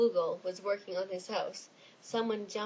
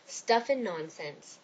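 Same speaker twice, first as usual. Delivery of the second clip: slow grumpy; 3 dB louder, slower than usual. Acted. Grumpy dismissal.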